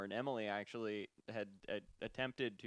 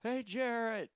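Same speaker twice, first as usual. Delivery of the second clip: high, raised call across a distance; imitated yell; 12 semitones higher, 5 dB louder, slower than usual. Retelling coworker yelling across the line, imitated holler. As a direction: mimic a friend hollering for attention. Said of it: "I hear Richard yell".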